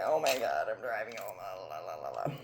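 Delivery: very soft, low mumble trailing away; woozy mumbling. Retold or imitated. Patient riding home after surgery, mumbling faintly to herself. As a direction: let the words sink into a hushed, low babble.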